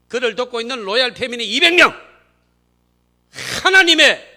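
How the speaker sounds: loud, high, climbing to a shout; fervent pulpit shout at the peak; building denunciation. Real, not acted. Preacher pronouncing God's judgment on the regime, building shout.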